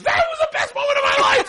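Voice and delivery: very high, loud retold yell; elated shout. Clayton erupts once they walk away, elated shouting. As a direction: burst out shouting in squealing delight.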